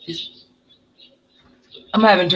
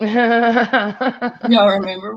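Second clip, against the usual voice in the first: a long, slow playful y'all; teasing drawl; 2 semitones higher, much slower than usual. Real, not acted. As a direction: stretch y'all out slowly, teasing the chat. Teasing the audience.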